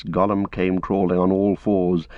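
usual speaking voice

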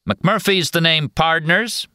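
high, slow drawling Western cowboy voice. Acted. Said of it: "in his drawling cowboy actor's voice".

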